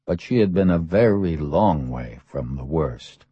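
ordinary delivery, nothing marked

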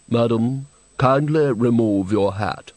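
a slow, stuffy gentleman's demand; red-faced gentleman ordering a hat off, slow and pompous; pompous request. A narrator's reading. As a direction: drawl it with starch.

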